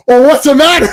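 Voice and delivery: mock angry outburst, high and loud; pissed off. Movie character taking offence over Dolomite, angry. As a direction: snap it loudly in pretend offence. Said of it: "he got super pissed off".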